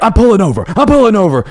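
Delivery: high, loud, frantic repeated yelling; mock driver shouting back; mock angry yell.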